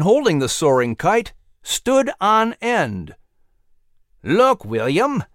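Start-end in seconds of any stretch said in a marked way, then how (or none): 4.2-5.2 slow excited call to his son; mild excited call; father calling his son to look; exclaiming. Acted. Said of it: "he exclaimed".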